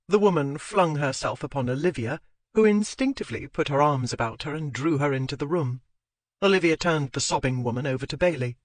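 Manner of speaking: usual speaking voice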